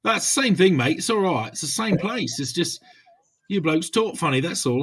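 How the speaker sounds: a hint of south London, half-joking; London copper accent. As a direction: imitate an ex-Met London copper. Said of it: "You, you do that accent so well".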